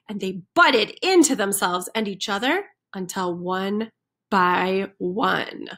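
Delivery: ordinary delivery, nothing marked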